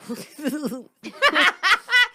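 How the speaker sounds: loud high laugh. Real, not acted. Laugh after yeah no.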